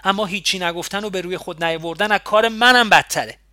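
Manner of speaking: angry reply. A narrator's reading. Woman answering Ali's calm question with an angry, loud retort. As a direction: fire the words back at him in anger. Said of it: "عصبانی جواب دادم".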